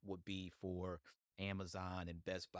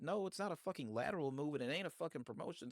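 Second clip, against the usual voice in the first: high, loud exasperated comeback; heated rebuttal; 8 semitones higher, 4 dB louder, faster than usual. Retold or imitated. Podcaster arguing with those denying a demotion, exasperated. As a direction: argue back hard, pitched up and forceful.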